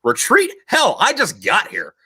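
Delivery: Retreat jumps way up in outrage, then slow and gruff; a hammed-up tough commander who refuses to retreat; defiant commander quote. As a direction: bark it like an unimpressed Marine commander. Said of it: "and I quote".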